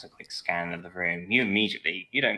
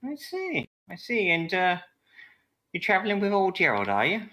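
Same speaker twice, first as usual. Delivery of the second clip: higher, quick knowing barmaid; 11 semitones higher than usual. Acted. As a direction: size him up with a knowing lilt. Barmaid voiced in scene.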